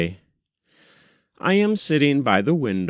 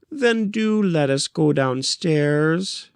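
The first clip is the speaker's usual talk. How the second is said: higher, coaxing plea to her husband; gentle pleading; 7 semitones higher than usual. Acted. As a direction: ask sweetly, hoping he gives in. Wife pleads with husband John.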